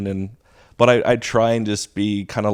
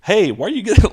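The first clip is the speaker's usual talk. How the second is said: startled teacher; 10 semitones higher, 7 dB louder, faster than usual. Retold or imitated. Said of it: "I'm like".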